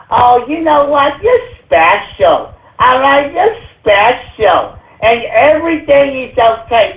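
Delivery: sugary mock-soothing teacher voice. Host mocking teachers telling kids they're special, mock coddling. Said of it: "is that what they're teaching these kids?".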